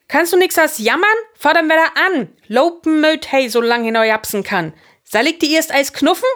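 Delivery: high, loud scolding; angry shout. A narrator's reading. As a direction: bawl out a whining friend crossly. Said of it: "schrie Fritz ärgerlich". (argerlich schimpen, luud)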